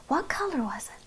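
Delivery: put-on hushed whisper to the child; whispered question. A narrator's reading. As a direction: whisper it like a secret. Asks child quietly.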